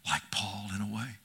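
soft and breathed, low; an intimate whisper to the congregation. Acted. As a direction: let it sink to a whisper. Hushed whisper aside.

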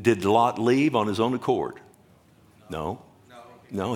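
usual speaking voice

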